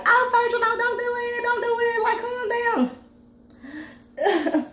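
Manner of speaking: high, pleading "no, don't do it"; pleading friends. Retold or imitated. Friends begging Phaedra to back down, high and pleading. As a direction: beg her to stop, voice up high. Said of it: "They're just like".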